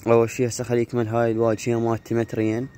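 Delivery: ordinary talk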